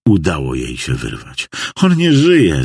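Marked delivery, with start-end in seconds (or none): 1.8-2.6 high, raised cry of a distraught wife. A narrator's reading. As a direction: call it out in horror, high. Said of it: "zawołała". (rozpaczliwe wołanie)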